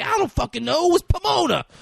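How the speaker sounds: loud, high-pitched defensive outburst; exasperated denial. Retold or imitated. Retells cornered man protesting under questioning.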